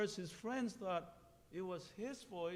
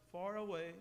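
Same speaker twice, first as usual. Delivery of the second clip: usual speaking voice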